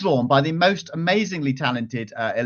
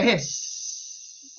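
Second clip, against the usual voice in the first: hissing; 6 semitones higher, 7 dB quieter than usual. Shown as a demonstration. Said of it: "do the sound of the hungry-eyed creatures".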